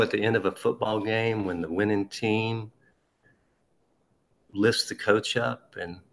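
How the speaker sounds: ordinary delivery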